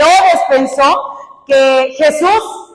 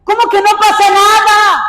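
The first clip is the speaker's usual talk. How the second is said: high, forceful incredulous question; raised-pitch preacher's outrage; indignant rebuke; 8 semitones higher, 4 dB louder than usual. Real, not acted. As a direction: throw the dismissal back indignantly. Rebukes the dismissive attitude.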